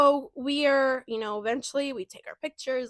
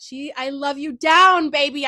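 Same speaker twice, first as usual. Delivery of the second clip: gushing shout; 2 semitones higher, 9 dB louder than usual. Real, not acted. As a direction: shout your love to her. Gushing love for best friend.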